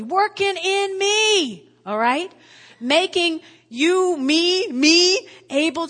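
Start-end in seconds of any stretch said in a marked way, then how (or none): 0.0-1.7 Working in me, slow and high, stretched out; slow emphatic stress. Acted. Preacher stresses key phrase. 2.8-5.9 louder and higher, slow, each me punched harder; emphatic build. Acted. Preacher builds to emphatic point.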